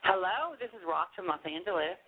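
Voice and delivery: normal talking voice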